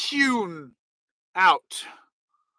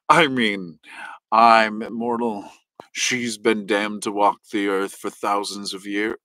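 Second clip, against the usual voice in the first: much lower, gravelly-free sleazy witch doctor croon; 11 semitones lower than usual. Acted. Witch doctor hitting on Nick's mom, very low voice. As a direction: drop way down and play a smarmy immortal witch doctor. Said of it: "The witch doctor would say".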